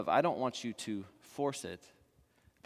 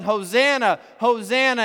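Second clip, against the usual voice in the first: loud, higher acclaiming cry of Hosanna; crowd yelling; 9 semitones higher, 10 dB louder than usual. Retold or imitated. Voicing the crowd hailing Jesus as king, loud cry. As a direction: call it out like the cheering crowd. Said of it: "when everyone is yelling".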